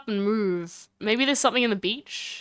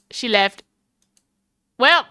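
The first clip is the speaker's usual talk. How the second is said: ordinary talk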